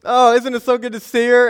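high, loud put-on cheer; fake enthusiasm. Calling brother over to rescue him, faking excitement. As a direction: call out brightly while bluffing. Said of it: "trying to fake it till you make it".

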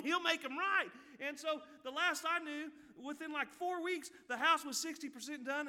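high, loud, rousing pulpit delivery; impassioned preaching. Real, not acted. Preacher telling the church how the prayer was answered, rousing preaching. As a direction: preach it out with the pitch pushed up.

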